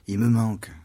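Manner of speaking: a hint of Magdalene crying out her loss; Mary Magdalene lamenting. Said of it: "comme dit Marie-Madeleine".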